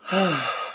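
audible breathy sigh; weary sigh. Real, not acted. Speaker pausing, lost for words, weary sigh. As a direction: let out a soft sigh.

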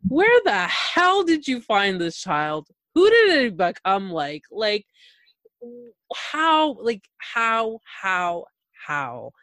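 rising disbelief, then trailing off; stunned where-did-this-come-from; mock disbelief, fading to a mutter; incredulous. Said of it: "And I'm just like".

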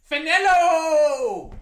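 hyped stadium call; excited call-out. Real, not acted. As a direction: shout the kicker's name like an announcer. Calling out the kicker's name.

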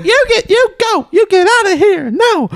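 very high falsetto scolding; mock manager yelling. Manager orders man out from across the bar, mock yelling. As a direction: imitate an outraged manager in a squeaky-high voice. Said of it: "she's yelling at him".

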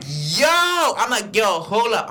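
high, retold "yo" of alarm; shocked callout. Driver catching couple in the backseat, shocked callout. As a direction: call out to halt them, pitch rising.

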